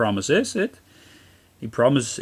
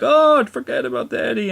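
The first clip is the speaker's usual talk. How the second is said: much higher, brushing-off tone; mock dismissive mother; 13 semitones higher than usual. Boy retelling the mother's harsh words, mock dismissive voice. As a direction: toss it away like it's nothing. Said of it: "and then she's like".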